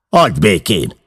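loud urgent call; shouted order. A narrator's reading. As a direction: command him to let go. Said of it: "kiáltotta".

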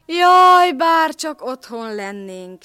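loud high wail, then slow lament; whining. A narrator's reading. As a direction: moan in self-pity. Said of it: "siránkozott a kakas".

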